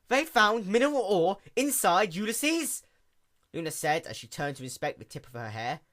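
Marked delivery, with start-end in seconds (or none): 0.1-2.8 high, loud shocked question; dismayed surprise. A narrator's reading. Princess reacts in shock to mining on her star. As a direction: gasp it out in dismay.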